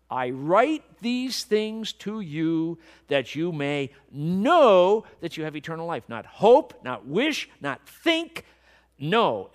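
loud preaching with hard-punched key words; worked up. Real, not acted. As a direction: hammer the key words with rising fervor. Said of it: "I'm getting worked up about this".

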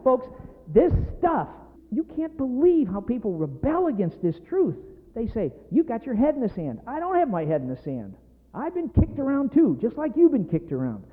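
worked up. Real, not acted. Preacher to folks who rebel against this truth, worked up. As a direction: press the congregation with heated insistence. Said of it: "I'm getting worked up now".

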